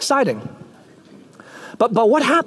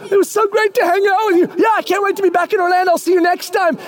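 gushing goodbye; 9 semitones higher, faster than usual. Acted. Fan saying goodbye, gushing.

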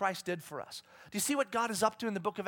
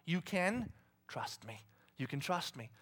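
tender reassurance; 5 semitones lower, 10 dB quieter than usual. Acted. God reassuring his people, soft and tender. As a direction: say it close and gentle.

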